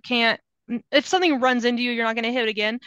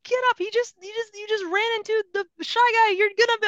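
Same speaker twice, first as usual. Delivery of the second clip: exasperated pleading; 9 semitones higher than usual. Acted. Player watching Yoshi run into Shy Guy, high helpless pleading. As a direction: whine helplessly at your character.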